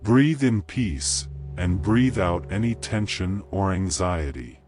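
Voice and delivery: calm, unhurried meditation voice with long pauses; guided meditation. Acted. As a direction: gently guide the listener into calm.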